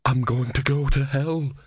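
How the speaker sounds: worried novice, muttering; muttered. Acted. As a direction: mutter it, dreading damnation. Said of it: "he muttered".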